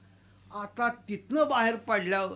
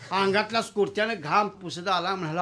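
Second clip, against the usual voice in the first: normal talking voice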